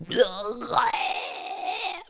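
high, drawn-out mock heave; imitated retching. Dude in my left ear, imitated retching. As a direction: mimic someone puking nearby. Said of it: "So I just hear this dude".

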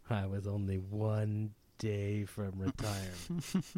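slow, soft weary voice; weary old lady voice. Retold or imitated. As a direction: drag it out tiredly. Mock quote of lady.

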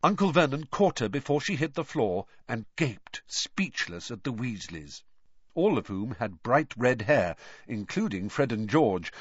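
usual speaking voice

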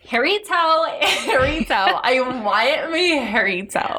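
put-on slow, drawn-out creature with an odd accent; hammed-up hollow monster calling. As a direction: drag out each word spookily. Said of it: "a hollow voice said".

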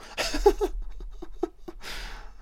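high, soft breathless laugh; long quiet cackle running out of air; wheezing laughter. Real, not acted. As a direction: laugh until it fades to a wheeze. Laughing at the host.

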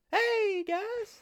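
tiny squeaky falsetto; talking-dog voice. Shown as a demonstration. Skip the dog greets the crew, very high squeaky voice. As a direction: squeak it way up high like a cartoon pup. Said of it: "What kind of voice do you think".

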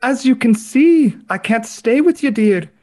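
wilt like a melting nanny; Mrs Doubtfire impression. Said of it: "You sounded like, uh, like Dobby".

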